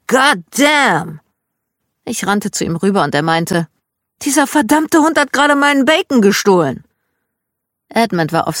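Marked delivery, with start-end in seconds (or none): 0.1-1.2 higher-pitched outburst of Dad's cursing; loud cursing. A narrator's reading. Dad cursing from his bed as breakfast arrives, loud outburst. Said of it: "hörte ich ein lautes". 4.2-6.8 loud, high-pitched indignant rant; furious at the dog. A narrator's reading. Dad complaining to his child about the dog, loudly outraged. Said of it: "so verdammt sauer auf den Hund".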